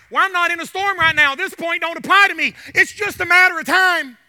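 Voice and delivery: loud, high preacher's voice; fervent preaching. Acted. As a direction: fire the objection out at the congregation. Preaches the objection at full voice.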